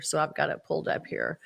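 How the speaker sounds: ordinary talk, nothing marked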